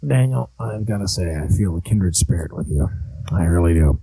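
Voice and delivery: Crazy Uncle Charlie voice. Acted. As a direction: growl it like a creepy uncle.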